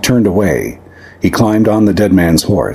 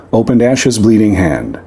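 ordinary delivery

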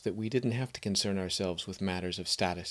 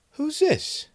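high, light cat voice, much higher, peering at a stranger; curious cat voice; 5 dB louder than usual. Acted. Barn cat questions the strange boy, high and curious. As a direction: play a sharp-eyed barn cat sizing someone up. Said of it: "she asked".

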